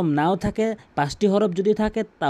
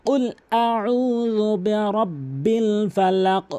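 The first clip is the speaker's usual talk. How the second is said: কুল আউযু বিরাব্বিল ফালাক drawn out slowly; slow correct recitation; 4 semitones higher, much slower than usual. Shown as a demonstration. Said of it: "এভাবে বলবেন". (ধীরে শুদ্ধ তিলাওয়াত)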